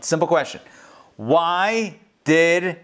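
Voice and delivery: ordinary delivery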